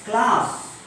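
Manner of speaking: CLASS with long open "ah", "klaas"; broad vowel in CLASS; British class. Shown as a demonstration. Teacher introducing British version, CLASS as "klaas". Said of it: "In British English".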